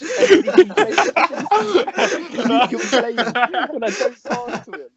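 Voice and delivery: laughing through the words; words breaking into helpless laughter. Real, not acted. Friend recounting teammate's complaints to mates, laughing.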